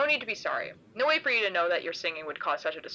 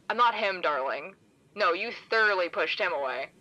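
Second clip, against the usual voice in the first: plain reading voice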